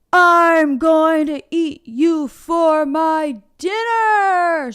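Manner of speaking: slow, loud, high troll threat; screaming troll. A narrator's reading. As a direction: scream it at the big goat. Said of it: "screamed the troll".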